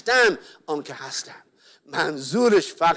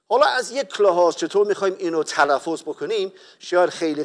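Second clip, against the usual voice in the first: usual speaking voice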